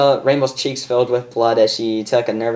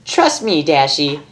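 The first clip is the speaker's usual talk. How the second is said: higher, laughing reassurance; Pinkie Pie voice; 8 semitones higher than usual. Acted. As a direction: reassure her with a giggle.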